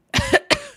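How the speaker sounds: a sharp, high, loud mock cough at the camera; fake cough. Streamer coughs at chat so they can skip work, high loud fake cough. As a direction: cough theatrically on everyone. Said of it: "can you cough on me".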